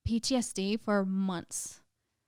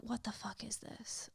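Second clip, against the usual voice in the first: hushed whisper of disbelief; stunned whisper; 15 dB quieter than usual. Retold or imitated. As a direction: breathe it out with no voice. Stunned reaction to packed show.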